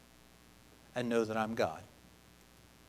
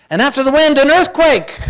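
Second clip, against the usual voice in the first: loud proclaiming; 9 semitones higher, 7 dB louder than usual. Acted. Building Scripture drama.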